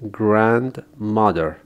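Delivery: grandmother کشیده و شمرده؛ grandmother بسیار آهسته و واضح; slow model word. Shown as a demonstration. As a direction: grandmother را آرام و شمرده تلفظ کن. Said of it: "با من تکرار کنید".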